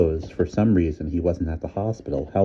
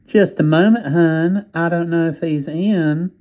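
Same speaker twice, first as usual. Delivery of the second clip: much higher, louder Southern operator voice, slowing at the end; sing-song Southern switchboard drawl; Southern operator putting a caller on hold; 12 semitones higher, 7 dB louder, slower than usual. A narrator's reading. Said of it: "The nasal voice came again".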